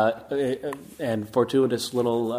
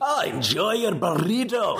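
slow, higher, hammed-up Scottish accent; terrible Scottish accent; 5 semitones higher, slower than usual. Shown as a demonstration. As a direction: ham up a bad Scottish brogue, stretched out. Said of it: "Or a terrible Scottish accent read".

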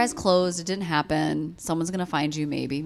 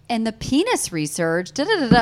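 louder, higher mock audiobook narrator; narrator blaring; 5 semitones higher, 8 dB louder than usual. Retelling loud audiobook in store lot, mock narrator. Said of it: "the narrator was like".

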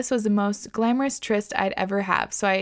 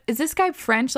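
ordinary talk

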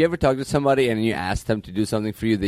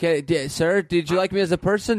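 needy service rep; 7 semitones higher than usual. Acted. Plays needy service rep on phone.

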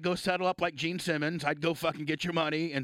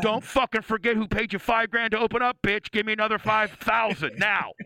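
mock angry demand; 5 semitones higher, 5 dB louder than usual. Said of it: "and then you'd be like".

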